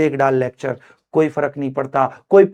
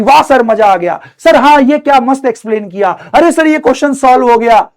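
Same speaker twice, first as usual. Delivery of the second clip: higher, louder eager student praising sir; imitating happy students; 7 semitones higher, 7 dB louder than usual. Teacher mimics students praising him in chat, eager and loud. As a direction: play a thrilled student gushing in chat. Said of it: "पढ़ाई वाले question पूछते हैं कि".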